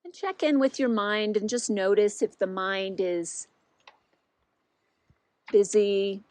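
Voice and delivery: unhurried guiding voice with long pauses; guided meditation. Real, not acted. Teacher settles listeners into body, mind and heart, unhurried guided meditation. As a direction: lead them gently inward, leaving long silences between phrases.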